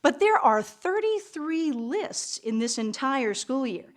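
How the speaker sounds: usual speaking voice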